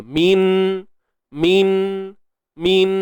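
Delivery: min with the nun said clearly; clear izhar nun. Shown as a demonstration. Teacher drilling the clear nun in min. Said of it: "Itu kata izhar, jelas".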